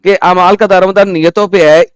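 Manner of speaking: ordinary talk, nothing marked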